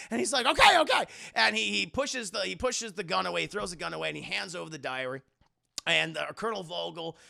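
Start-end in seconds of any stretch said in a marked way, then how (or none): 0.5-1.0 high, loud mock panic; frantic surrender. Indy gives up the diary, frantic surrender. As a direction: give in hastily, loud and high.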